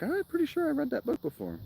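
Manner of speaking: squeaky-high self-quote; high put-on voice. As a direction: voice your own past thought in a very high pitch. Quotes own earlier thought, put-on voice.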